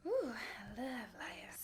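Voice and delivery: slow, breathless whisper of delight; hushed, slow, dreamy whisper; swooning whisper. Real, not acted. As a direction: whisper it like a secret crush. Swooning over a fragrance.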